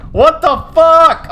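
loud, high, drawn-out outburst; exasperated shout. Real, not acted. Gamer stumped by a puzzle, exasperated shout. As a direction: yell it slowly in disbelief.

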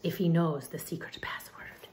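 soft unvoiced whisper about a secret. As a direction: breathe it like a secret. Tia Mia whispers.

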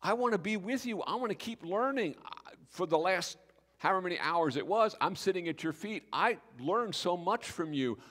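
high, loud, pleading to go along; a healed man eagerly asking Jesus to take him along. Pretend. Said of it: "he comes to Jesus".

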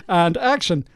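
usual speaking voice

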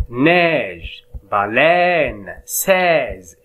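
"Neige", "baleine", "seize" each stressed and falling; listen for the sound. Shown as a demonstration. Teacher repeating words so pupils hear the sound. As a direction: highlight the shared vowel sound. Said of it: "quel est le son qui se répète ici?".

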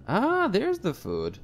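slow, high, drawn-out delight. Real, not acted. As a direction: stretch it out, pleased and high. Player finds food, delighted.

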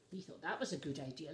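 usual speaking voice

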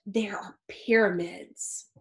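ordinary delivery, nothing marked

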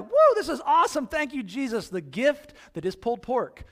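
high, loud whoop of delight over pulled pork; excited cheer. Pretend. Preacher to congregation, loud excited cheer. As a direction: cheer like a barbecue lover. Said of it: "I'm just like".

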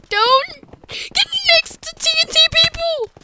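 very high, strained yelp; shrieked warning. Real, not acted. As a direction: screech the warning in panic. Warning after life lesson.

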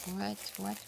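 ordinary talk

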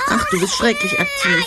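half-laughing, half-whining complaint; a laughing cry of mock despair; laughing exasperation. [name] teasing Regina's eagerness.

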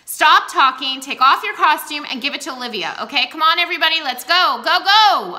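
loud, high, impatient commands; angry rush. A narrator's reading. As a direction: herd the cast out in a fury. Furious director hurrying everyone.

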